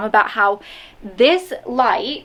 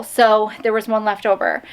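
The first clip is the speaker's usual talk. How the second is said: normal talking voice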